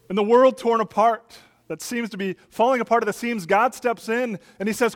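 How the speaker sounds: normal talking voice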